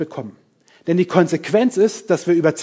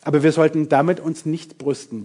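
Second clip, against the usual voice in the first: usual speaking voice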